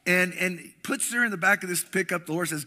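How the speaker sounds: usual speaking voice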